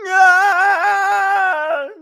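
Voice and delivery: high, loud, held falling scream; drawn-out stuntman's yell; long theatrical scream. Acted. Shatner's stunt in acted scene.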